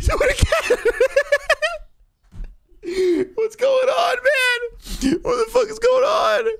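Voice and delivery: panicked shriek. Acted. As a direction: shriek it like the world is breaking. Panics at glitching customers.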